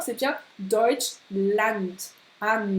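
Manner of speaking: slow Deutschland, oi then a clear an at the end; slow careful Deutschland. Shown as a demonstration. Teacher spelling out Deutschland slowly for students. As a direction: stretch Deutschland, land ending like an. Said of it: "comme si t'avais un an derrière".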